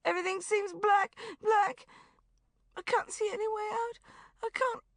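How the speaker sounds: weep it out in a high voice; sobbing. Acted. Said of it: "she sobbed".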